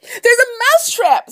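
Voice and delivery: very high, loud, slow wail of alarm; panicked mouse. Acted. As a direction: cry the warning as a squeaky mouse. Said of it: "said the mouse".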